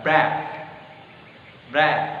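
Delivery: "Breath" with the th, tongue under the upper teeth; model word breath. Shown as a demonstration. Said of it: "lidahnya di bawah gigi atas". (contoh pelafalan "Breath")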